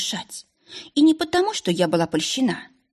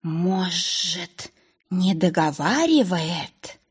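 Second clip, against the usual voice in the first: hissing snake; much slower than usual. Acted. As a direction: hiss it slowly and suspiciously. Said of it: "прошипела у меня в голове Буся".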